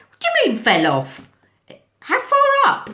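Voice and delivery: high, loud anxious questioning; alarmed questions. Retold or imitated. Teacher questioning the boy about the fall, alarmed. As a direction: demand answers in a fright.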